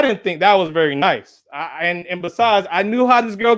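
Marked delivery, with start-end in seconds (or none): none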